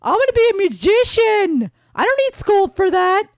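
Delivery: mock indignant daughter; defiant teen. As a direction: argue back like a stubborn teen girl. Voicing Trucy arguing.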